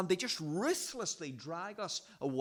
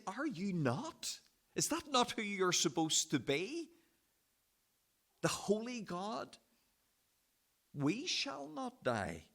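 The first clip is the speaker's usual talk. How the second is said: higher, pleading and accusing question to God; indignant challenge; 5 semitones higher than usual. Shown as a demonstration. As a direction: confront God, protesting and incredulous. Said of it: "I think he's saying".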